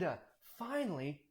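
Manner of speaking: usual speaking voice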